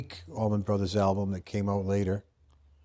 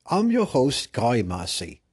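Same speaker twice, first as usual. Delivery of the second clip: high, loud, theatrical British announcer; mock British host; 4 semitones higher, 4 dB louder than usual. Host opening a special premiere edition, mock British. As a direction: introduce the show in a hammed-up British accent.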